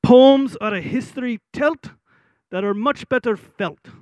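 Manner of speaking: high Scottish voice, pausing mid-rhyme; Scottish accent. Retold or imitated. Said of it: "in his Scottish accent".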